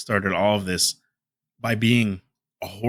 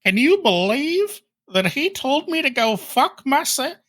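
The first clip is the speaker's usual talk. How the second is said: very high, mocking put-on accent; squealing caricature accent of outraged disbelief; D S P impression. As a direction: push the silly accent way up high. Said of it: "That's my D S P".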